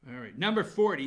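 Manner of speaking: ordinary delivery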